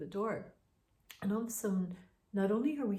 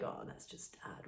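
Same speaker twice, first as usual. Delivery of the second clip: voiceless whisper, much softer; 14 dB quieter than usual. Retold or imitated. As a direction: whisper it under the covers. Guessing the noise is dad.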